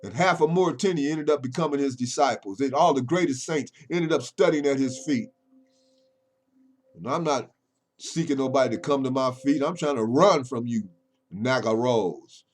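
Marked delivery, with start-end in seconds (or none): 0.0-9.1 loud, high, driving preacher delivery; full-force preaching. Acted. Preacher warning his critics they'll get it last, preaching at full force. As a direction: preach it hard at the doubters.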